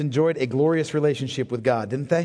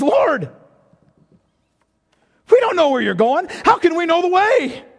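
higher, louder anxious pleading disciple; fearful Thomas; 10 semitones higher, 5 dB louder than usual. Acted. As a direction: cry out as worried Thomas. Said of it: "doubting, fearful Thomas".

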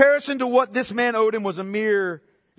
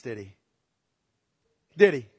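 usual speaking voice

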